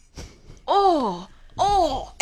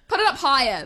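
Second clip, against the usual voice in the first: ordinary delivery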